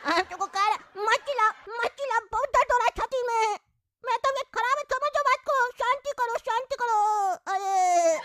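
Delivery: high, strained and pleading; pained old man. Acted. Sick father pleading with the shouting neighbour, high and strained. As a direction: whimper like a frail sick father.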